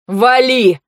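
loud, fed-up order; exasperated yell. A narrator's reading. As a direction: yell it, at the end of your patience. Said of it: "проорал выведенный из себя Тир".

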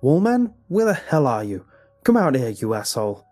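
loud challenge, starting high and dropping; angry shouting. A narrator's reading. Father challenges son's imaginary friend, angry shouting. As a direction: bellow a furious summons at a hidden enemy.

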